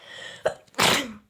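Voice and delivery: loud real sneeze; sudden loud sneeze; sneezing. Real, not acted. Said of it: "Hapşurdum da".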